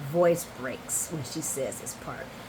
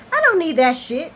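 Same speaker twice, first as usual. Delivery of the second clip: angry outburst; 8 semitones higher, 8 dB louder than usual. Retold or imitated. Finn lashing out, retold.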